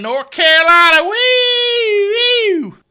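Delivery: very high, loud party whoop; wild whoop. Acted. Host bragging about his old perm, loud high whooping.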